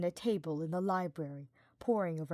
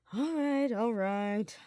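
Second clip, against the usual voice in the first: groaned out; 4 semitones higher, 5 dB louder, much slower than usual. Acted. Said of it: "Sylvain groaned out".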